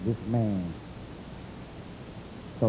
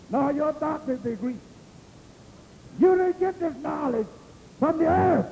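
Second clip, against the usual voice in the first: high-pitched, insistent preacher cadence; emphatic pulpit proclamation; preaching; 11 semitones higher than usual. Acted. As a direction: drive each phrase home high, with pauses between. Preaches at full force.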